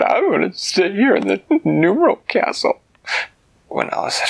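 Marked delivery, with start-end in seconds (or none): 0.0-2.8 put-on high-pitched babyish bear voice; reluctant sad bear. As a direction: plead to stay behind in a small, high voice. Sad Bear refuses to go along.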